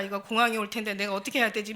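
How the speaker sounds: ordinary talk, nothing marked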